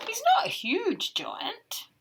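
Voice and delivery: whispered aside. Real, not acted. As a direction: whisper it like a secret.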